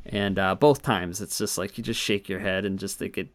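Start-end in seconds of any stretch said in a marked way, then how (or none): none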